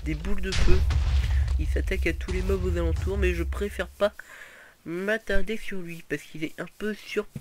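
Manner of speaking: loud, fast, excited boss commentary. Real, not acted. Gamer to viewers on spotting the boss, excited and loud. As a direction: rattle it off, thrilled the boss showed up.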